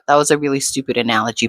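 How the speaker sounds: ordinary talk, nothing marked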